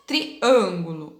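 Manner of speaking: Triângulo slowly, the â closed and nasal. Shown as a demonstration. Teacher showing pupils the closed â in Triângulo. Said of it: "o som da letrinha A, ó, mais fechada".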